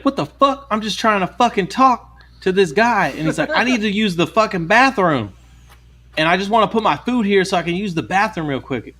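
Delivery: high-pitched, worked-up mock protest; crazy guy. Crazy customer arguing with the manager, in mock agitation. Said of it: "there was a fucking crazy guy".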